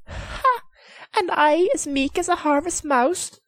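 high, teasing lady of the castle; Lady McSweeney's voice. Acted. The lady teasing her husband, high and playful.

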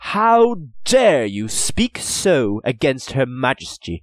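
loud, rising indignation; outraged. A narrator's reading. Merchant indignant at the insult to Her Majesty. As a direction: swell with affronted fury.

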